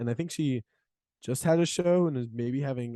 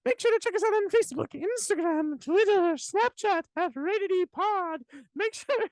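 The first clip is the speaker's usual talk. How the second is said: high squeaky leprechaun voice; much higher, impish little leprechaun. Pretend. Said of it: "like a scary little leprechaun".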